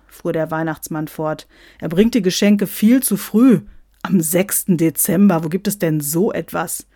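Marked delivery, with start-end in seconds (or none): 1.8-6.9 mildly outraged, louder complaint; indignant. Acted. Santa objects to gifts on December sixth, indignant. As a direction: protest emphatically at the breach of custom.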